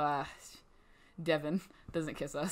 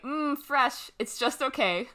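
high, louder mock voice of a guy unimpressed by fruit; voicing a picky boyfriend; 7 semitones higher, 5 dB louder, slower than usual. Boyfriend tasting the fruit, high mock unimpressed voice. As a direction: play him sniffy and underwhelmed. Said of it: "He's like".

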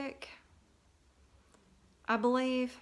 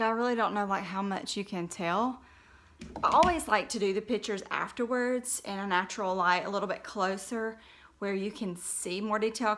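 ordinary delivery

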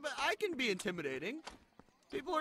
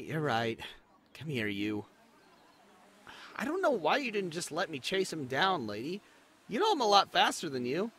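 ordinary delivery

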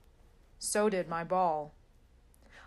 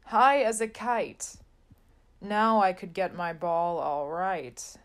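plain reading voice

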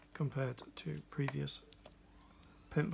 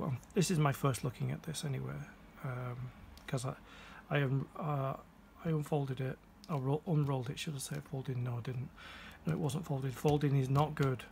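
normal talking voice

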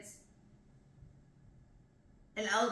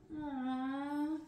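soft, higher, drawn-out aww; tender little sound of sympathy; touched "aww"; 5 semitones higher, 3 dB quieter than usual. Real, not acted. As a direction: coo a long, gentle aww. Touched by the poem.

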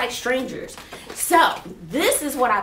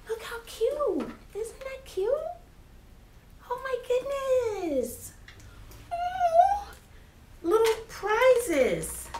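much higher, soft and slow coo; 13 semitones higher, 5 dB quieter, much slower than usual. Real, not acted. Teacher cooing softly at little prizes for students.

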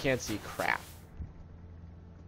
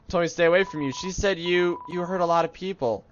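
put-on slightly higher, uneasy kid voice; earnest kid character; 4 semitones higher, 3 dB louder than usual. Kid confronting player about hurting people, earnest voice.

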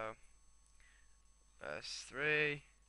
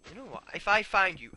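raised, louder threatening voice; mock threat; 7 semitones higher, 10 dB louder than usual. Gamer threatening the sheep, mock anger. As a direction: warn the culprit loudly and higher.

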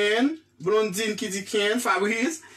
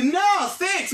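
higher, forceful no thanks; emphatic refusal; 6 semitones higher than usual. Retold or imitated. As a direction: turn down the offer firmly. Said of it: "E pi kounye a mwen sa m di". (refi fò ak vwa wo)

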